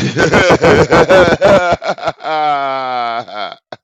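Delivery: a burst of loud real laughter. Real, not acted. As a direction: crack up at your own line.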